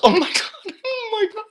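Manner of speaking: laughing, squealing very high; overjoyed disbelief. Real, not acted. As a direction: let the amazement burst out. Overwhelmed realization, laughing.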